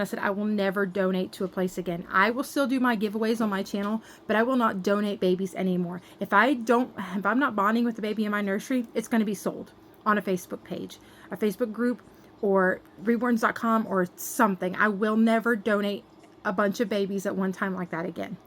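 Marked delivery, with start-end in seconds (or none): none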